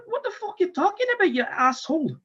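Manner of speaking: high, indignant squeal; incredulous retort. Retold or imitated. Patient answering the doctor's diagnosis, high indignant retort. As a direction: snap back high in disbelief.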